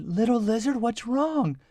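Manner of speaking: hushed, kindly voice; gentle concern. Acted. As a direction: ask it tenderly, like a worried child. Kids gently ask crying lizard.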